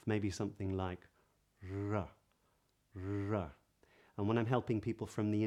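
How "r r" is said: R with the tongue tip raised near the roof of the mouth; tongue too close R. Shown as a demonstration. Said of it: "the kind of pitfalls that you might fall into".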